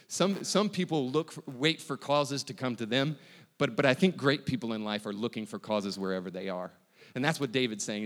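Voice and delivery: ordinary talk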